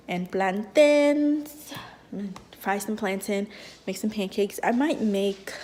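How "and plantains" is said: drawn out. Acted. Drawn-out food announcement.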